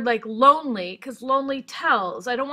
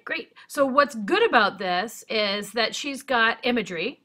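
ordinary delivery

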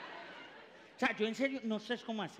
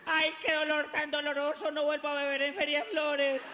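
loud pained wail; hungover mother in labor; 4 semitones higher, 5 dB louder than usual. Retold or imitated. Mother regretting drinking in labor.

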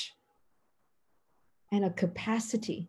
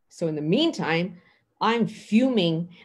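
usual speaking voice

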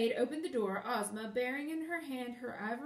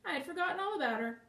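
high, quick admission; breezy, hurried excuse; ready agreement; 6 semitones higher, 4 dB louder, faster than usual. A narrator's reading. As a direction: toss it off airily. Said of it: "said Langwiter readily".

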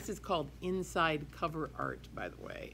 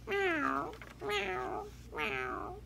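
high mewing meows; cat meow; much slower than usual. Acted. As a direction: mew like a kitten, up high. Teacher imitates cat.

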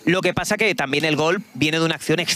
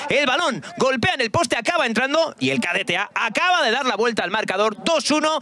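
excited goal recap, much higher; breakneck goal commentary; 8 semitones higher than usual. Real, not acted. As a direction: keep the goal euphoria going. Still celebrating the goal.

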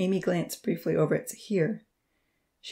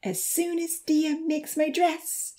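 much higher, slow excited exclaim; 10 semitones higher, slower than usual. A narrator's reading. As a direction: gush about the dress. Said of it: "she exclaimed".

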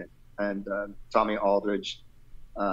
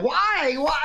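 high, wailing mock complaint; hammed-up cry of despair; 3 dB louder than usual. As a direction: plead upward in playful anguish. Mock despair about giving up.

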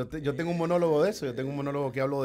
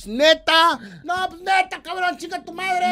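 much higher, put-on Mexico City chilango accent; a cocky chilango heckler, pitched way up; chilango heckle; 13 semitones higher, 6 dB louder, slower than usual. Heckler shouting up at the ticket seller, chilango accent. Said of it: "ese hablado chilango".

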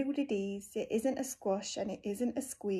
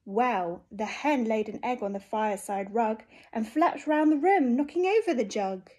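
plain reading voice

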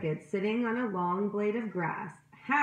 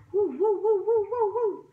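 high, louder woo woo woo; bat wing whoosh; 12 semitones higher, 5 dB louder than usual. A narrator's reading. The bat flies overhead, high whooshing wing sounds. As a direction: whoosh it like flapping wings. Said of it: "sound was coming from the little bat's quick wings".

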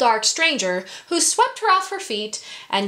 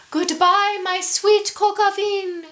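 calling out sweetly; 11 semitones higher than usual. Acted. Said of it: "called Mitzi".

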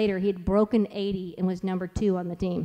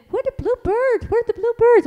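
put-on high child voice, eager; little boy asking; 5 dB louder than usual. Mother imitates her toddler asking about birds. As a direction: ask it like a wide-eyed little kid. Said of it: "he goes".

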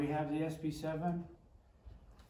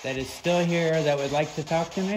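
calling out loudly; 5 dB louder than usual. Real, not acted.

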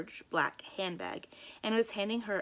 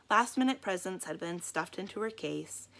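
plain reading voice

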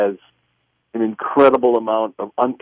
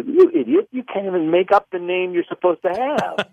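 higher-pitched inner rebuke; exasperated self-scolding; 7 semitones higher than usual. Retold or imitated. Berating himself over a made-up name, exasperated. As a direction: scold yourself in disbelief. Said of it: "I was thinking consciously".

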